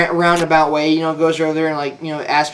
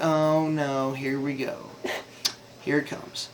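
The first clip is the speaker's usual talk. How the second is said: dread; 3 semitones lower, 11 dB quieter, slower than usual. Retold or imitated. Dreading being caught.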